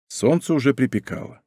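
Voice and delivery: plain reading voice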